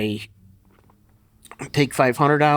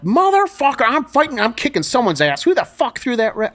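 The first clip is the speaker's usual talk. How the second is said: much higher and louder, raging at the judges; furious yell; 11 semitones higher, 5 dB louder than usual. Retold or imitated. Lifter yelling at the judges after two reds, furious shout. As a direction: rage at the judges over the red lights. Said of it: "I'm here like".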